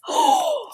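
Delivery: high startled gasp; sudden fearful intake of breath; sharp gasp. Acted. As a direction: gasp in fright at the eyes. Wolf eyes in the dark.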